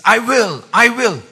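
"I will" punched high and loud, twice; powerful I will. Shown as a demonstration. Preacher explaining what the Greek means, powerful stress on "I will". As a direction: declare "I will" with full force. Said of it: "it doesn't come out so powerfully".

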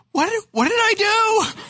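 high, loud startled cry of confusion; panicked what-did-I-do; alarmed. Retold or imitated. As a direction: blurt it out in sudden alarm. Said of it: "my immediate reaction was".

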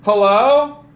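normal talking voice

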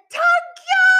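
high loud screamed thanks. Retold or imitated. Leaving the embassy, she screams her thanks. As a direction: scream it to the sky. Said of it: "And I scream and I said".

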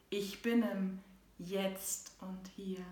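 measured, rhythmic poem recital; poem reading. Acted. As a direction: read it like a heartfelt poem. Continues reading her poem.

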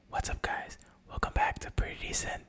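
whispered podcast intro; hushed breathy greeting; whispering. Shown as a demonstration. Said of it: "fully whispering".

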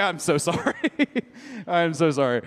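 sorry through laughter; laughing husband apologising to wife; laughing apology. Retold or imitated. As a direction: apologise while cracking up.

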